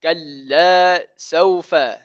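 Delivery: slow, drawn-out recitation; teacher modelling the verse for students, slow recitation; Quran recitation. Shown as a demonstration. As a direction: chant the verse slowly and evenly.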